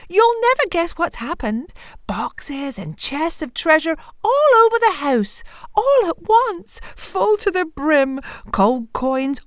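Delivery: high-pitched, amazed storybook wife; excited wife. A narrator's reading. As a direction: marvel at the treasure, drawing out phrases. Wife tells of sudden treasure.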